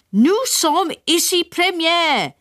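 slow high English French; English-accented French. A narrator's reading. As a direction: translate slowly in clumsy French. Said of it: "translated my father".

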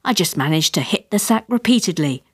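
ordinary delivery, nothing marked

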